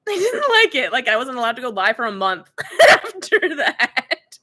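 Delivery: high, laughing delivery breaking into giggles. Real, not acted. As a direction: talk through real laughter. Laughs telling of the ban.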